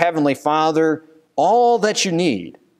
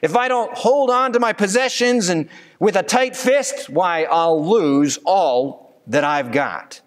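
higher, worried voice of the listener clinging on, slowing at the end; anxious excuse; 6 semitones higher than usual. Pretend. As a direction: play the fearful holdout who won't let go. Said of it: "you say".

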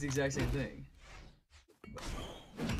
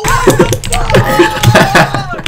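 frustrated shout; 10 semitones higher, 8 dB louder, much slower than usual. Real, not acted. Raging at the game.

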